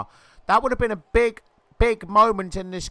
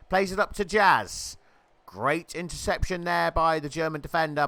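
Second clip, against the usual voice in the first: ordinary talk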